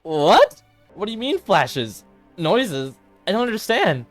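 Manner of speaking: high, startled questioning; baffled alarm. Acted. Sayori hears of her friend's visions, baffled alarm.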